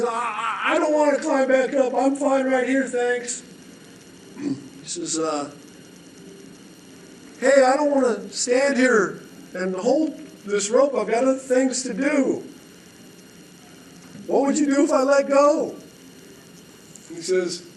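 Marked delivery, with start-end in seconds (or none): 0.0-3.4 high, shouted refusal. Acted. Dangling man refusing to climb, high and shouted. 7.4-15.7 exasperated holder. Acted. Holder fed up with the dangling man, higher and impatient.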